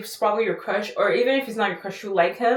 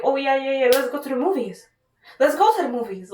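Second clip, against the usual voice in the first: higher, eager and quick to agree; 4 semitones higher than usual. Retold or imitated. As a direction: jump at the offer like a hopeful guy. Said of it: "he'll be like".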